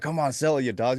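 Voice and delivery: mock coach yelling at the market; urging the market on. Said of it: "instead of saying, like".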